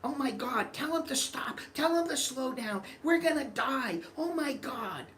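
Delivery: very high, frantic pleading; hammed-up terrified old lady in a racing van; mock panicked mother. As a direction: shriek like you're about to crash. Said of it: "she's freaking out".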